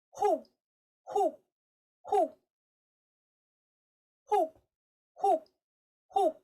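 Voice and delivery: string of short, high owl hoots. Shown as a demonstration. Teacher leading the class to hoot, owl hoot. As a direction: hoot loud and high, dropping off. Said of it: "hoot like an owl".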